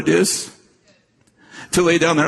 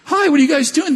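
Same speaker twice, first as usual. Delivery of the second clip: high cheerful greeting; 13 semitones higher than usual. Retold or imitated. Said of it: "I said".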